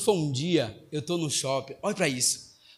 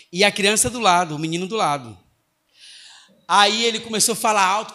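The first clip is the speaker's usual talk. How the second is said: usual speaking voice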